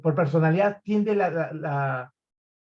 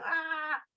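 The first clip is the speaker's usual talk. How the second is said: high, soft mock scream; 10 semitones higher, 9 dB quieter than usual. Trainer imitating sanguine party-goers screaming, high soft mock scream. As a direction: hold back an imitated squeal. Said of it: "y gritan".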